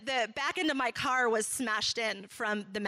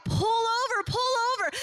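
higher and louder urgent calls; retold shouting; 8 semitones higher, 4 dB louder than usual. People on the roadside shouting at the wife to pull over. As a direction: yell at a passing car to stop.

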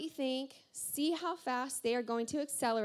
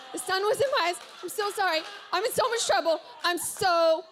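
high, loud mock distress; acted frantic excuses; 5 semitones higher, 4 dB louder than usual. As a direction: plead your excuse in a raised, flustered pitch. Acting out frantic excuses to victim.

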